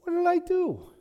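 high, wounded whine; little brother whine. Retold or imitated. David answering his bossy big brother in a high whine. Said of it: "the classic little brother response".

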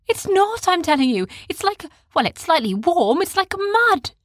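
put-on reassuring, matter-of-fact child; boy voice. A narrator's reading.

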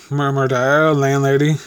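usual speaking voice

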